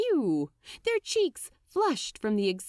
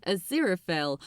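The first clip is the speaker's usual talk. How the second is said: usual speaking voice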